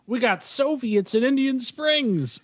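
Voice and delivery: high, slow mock alarm; squeaky alarm. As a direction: drag it out in a squeak. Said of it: "Travolta's like".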